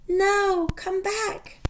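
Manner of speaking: high, loud plea calling after them; child calling after lost friends; crying out. Acted. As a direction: call out desperately as they fly off. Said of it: "Lissy cried".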